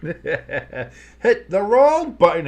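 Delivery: slow, sheepish laugh through the words; laughing at himself. Real, not acted. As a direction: chuckle it out, embarrassed. Laughs at own mistake.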